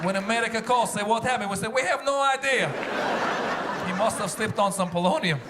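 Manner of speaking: deadpan Russian plotter giving instructions; Russian official in a comedy bit. Acted.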